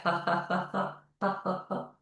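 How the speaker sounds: soft, evenly spaced ha-has; subdued exercise laugh, fading away; laughing sounds. Shown as a demonstration. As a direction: chant a quiet fake laugh while patting. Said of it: "Making your laughing sounds".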